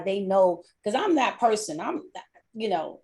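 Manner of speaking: usual speaking voice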